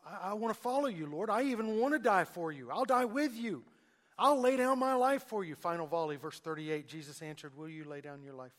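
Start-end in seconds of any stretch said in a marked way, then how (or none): 0.1-5.6 rising and louder, swept up in boastful zeal; Peter's eager vow. Acted. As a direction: promise everything, building louder to the end.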